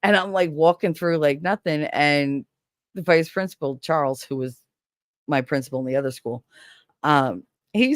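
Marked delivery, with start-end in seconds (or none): none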